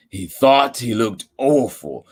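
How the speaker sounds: ordinary delivery, nothing marked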